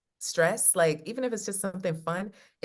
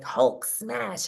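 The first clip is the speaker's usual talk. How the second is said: Hulk voice; 3 semitones higher than usual. Pretend. Voicing the Hulk in video.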